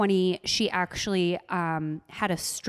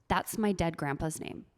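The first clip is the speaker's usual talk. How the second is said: imitated grave, softer reply; dead serious; 3 semitones lower, 6 dB quieter than usual. Boyfriend answers teasing about grandpa's name, dead serious. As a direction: drop the volume and say it in all seriousness. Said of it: "he was dead serious".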